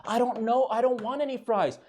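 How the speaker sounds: coy and innocent; mock girlfriend voice. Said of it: "This is the moment".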